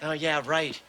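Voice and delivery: ordinary delivery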